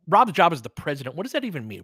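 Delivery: normal talking voice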